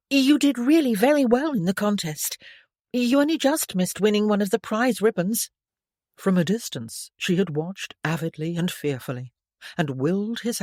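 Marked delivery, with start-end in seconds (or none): 0.1-5.5 put-on lightly higher girlish voice, bright and polite; a hint of a shy girl making conversation; polite young lady. A narrator's reading. Stephanie congratulating Bertrand on the archery, lightly girlish.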